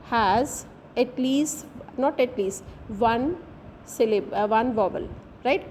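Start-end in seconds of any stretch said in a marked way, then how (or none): none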